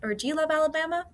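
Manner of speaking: high, quick teasing jab at a rival fan; mock taunting fan. Teasing a Georgia fan about rival teams, high and quick. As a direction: needle a Georgia fan playfully. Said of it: "being like".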